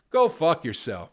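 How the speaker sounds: loud, sharp brush-off; a loud fed-up go fuck yourself; angry outburst. Real, not acted. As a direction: spit it out at them. Angry rant at critics.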